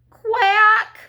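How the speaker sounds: loud, high panicked quack; frightened duckling cry. A narrator's reading. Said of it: "cried number five".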